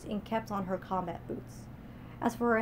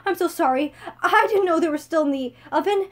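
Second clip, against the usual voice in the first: higher and louder frightened plea; whimpering; 8 semitones higher, 9 dB louder than usual. Acted. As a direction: beg forgiveness, scared. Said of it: "she whimpered".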